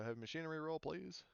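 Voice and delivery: ordinary talk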